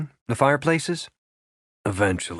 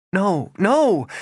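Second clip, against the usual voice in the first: agitated protest; 9 semitones higher than usual. A narrator's reading. Regan protesting to Rafe, an agitated high no no. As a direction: push the words away. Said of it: "flinging up her arms".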